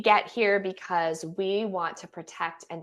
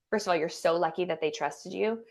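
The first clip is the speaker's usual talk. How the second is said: ordinary talk, nothing marked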